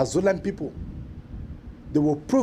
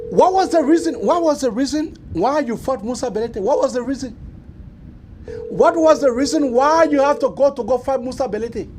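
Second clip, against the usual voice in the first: loud demanding; 7 semitones higher, 5 dB louder than usual. Acted. Host demanding answers on air.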